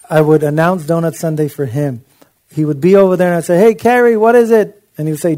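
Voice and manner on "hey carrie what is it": higher and louder, a cheery call across the room. Retold or imitated. Pastor calling out to Carrie at Donut Sunday, loud and cheery. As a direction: call out to him like a host.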